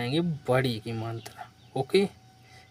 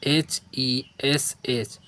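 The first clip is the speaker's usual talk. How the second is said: letter by letter, spaced out; dictating letters to a class; slow spelling; much slower than usual. Shown as a demonstration. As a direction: spell each letter slowly. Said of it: "तो क्या पढ़ेंगे?".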